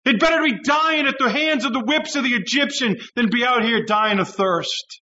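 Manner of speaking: high mocking shouted whine; mock the grumblers yelling at God; whiny Israelites. Preacher imitating Israelites shaking fists at God, high shouted whine. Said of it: "they shake their fist at God and say".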